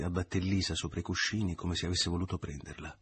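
ordinary delivery, nothing marked

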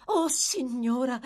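high, slow, emphatic exclamation. A narrator's reading. The girl answers one of the women, emphatically. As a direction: draw it out with great emphasis. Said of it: "rispose con enfasi la ragazza".

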